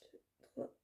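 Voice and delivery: barely audible, whispery count; counting to oneself. Real, not acted. Vlogger looking for a shade name, counting under the breath. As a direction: count off almost silently.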